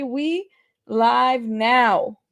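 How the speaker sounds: extremely slow, elongated words; going-live announcement. Real, not acted. Streamer tells everybody we live, very drawn out. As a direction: draw the words right out.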